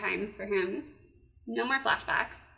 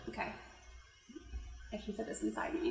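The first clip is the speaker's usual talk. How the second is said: embarrassed whisper; 3 semitones lower, 13 dB quieter, faster than usual. Real, not acted. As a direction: giggly whisper.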